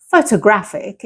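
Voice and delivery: photographic stressed on GRAPH; careful model photographic with the beat on GRAPH; stress near the end. Shown as a demonstration. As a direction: hit pho-to-GRAPH-ic late in the word. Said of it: "instead is right at the end".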